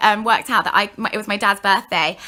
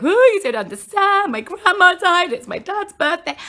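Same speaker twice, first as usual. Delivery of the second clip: high mock-sobbing wail; hysterical sobbing; 10 semitones higher, 3 dB louder than usual. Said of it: "I was just sobbing hysterically".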